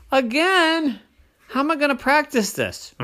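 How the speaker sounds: very high, loud exasperation; high whiny dismay; dismayed kid. A narrator's reading. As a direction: wail it in disbelief. Oren dismayed after failed greeting.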